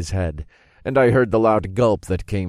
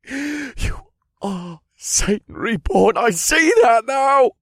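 frantic tearful pleading; 6 dB louder than usual. Acted. Warlock bargaining with his killer, frantic and terrified. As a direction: plead for your life, building to a cry.

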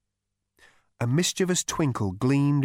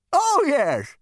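put-on high, scornful old man; ghostly great-grandfather scolding; crotchety old ghost; 6 dB louder than usual. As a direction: sneer down at the useless great-grandson. Ghost recalls.

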